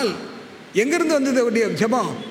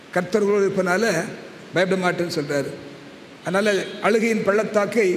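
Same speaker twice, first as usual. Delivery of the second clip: usual speaking voice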